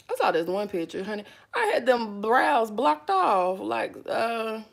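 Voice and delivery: usual speaking voice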